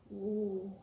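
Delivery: usual speaking voice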